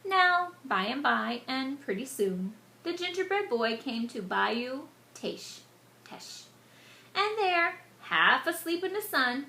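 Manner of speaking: ordinary delivery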